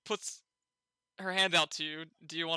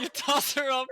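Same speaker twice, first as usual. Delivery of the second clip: laughing; 8 semitones higher, 5 dB louder than usual. Real, not acted.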